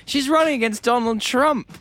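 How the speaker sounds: high-pitched incredulous outburst; exasperated retort. Real, not acted. Comedian answering Clinton's critics, exasperated high outburst. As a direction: fire back in disbelief, pitched high.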